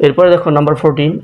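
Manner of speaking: ordinary talk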